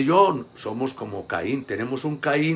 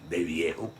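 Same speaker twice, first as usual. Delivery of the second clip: ordinary delivery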